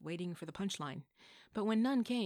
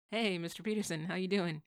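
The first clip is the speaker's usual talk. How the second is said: bright greeting; 7 dB louder than usual. Acted. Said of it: "he asked brightly".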